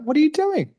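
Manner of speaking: high, astonished cry; retold disbelief. To the friend filling her truck, high astonished cry. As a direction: jump way up in pitch, amazed he paid. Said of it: "I'm like".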